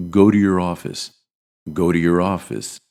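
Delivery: "Go to your office" as "go ra yer roffice"; whole line linked and reduced; one smooth unit, "go" stressed; fully phrased sentence. Shown as a demonstration. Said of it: "The result of all this wonderful phrasing is".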